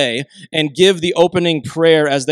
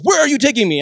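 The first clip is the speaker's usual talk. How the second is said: higher-pitched mock outrage; alarmed protest; 7 semitones higher, faster than usual. Teen son to dad driving past the turn, alarmed protest. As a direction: imitate a panicked teen demanding answers.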